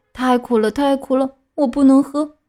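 lightly voiced whiny puppet, pitch climbing; a touch of a child's rising protest; whining refusal. Puppet refuses the fairy's bitter medicine, mildly whining.

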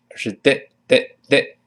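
"的" said short and light, like a quick d sound; a clipped, light "的" again and again; short flapped d. Shown as a demonstration. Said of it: "所以不要读D". (轻短的"的")

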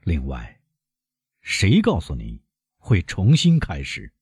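usual speaking voice

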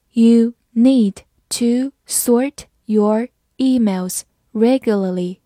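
very slow, each word spaced; slow reading. Shown as a demonstration. Teacher slowly reading the example sentence. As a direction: read it slowly for learners. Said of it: "好，慢读一遍".

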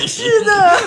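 long high laugh. Real, not acted. Laughing along with the host's joke, high and long. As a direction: burst out laughing, then agree.

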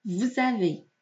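vous avez linked with a z sound; voiced liaison. Shown as a demonstration. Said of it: "我们要浊化它". (vous avez连读，s浊化为z)

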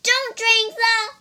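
high, loud, cut-off warning; urgent shout. Acted. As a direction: yell before it's too late.

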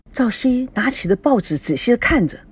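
ordinary delivery, nothing marked

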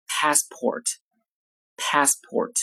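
"passport" with a flat front "a", as in "cat", American style; American pronunciation. Shown as a demonstration. Teacher giving the American "passport" after the British. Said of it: "到美式里面发作".

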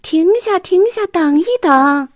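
high, loud yell after a bus; shouting. Acted. Arthur yelling at the departing bus, loud shout. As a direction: call out to stop the bus. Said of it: "亚瑟大声喊".

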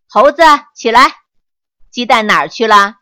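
loud, fast shout at the monkey; big shout. A narrator's reading. Master shouts at the monkey, loud and fast. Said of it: "便大喊".

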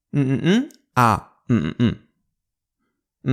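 ordinary delivery

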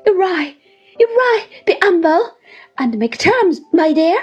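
high, loud cry of alarm; agitated. A narrator's reading. Mother begs her son to make terms, agitated. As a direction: plead urgently, pitched well up. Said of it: "who cried out in much agitation".

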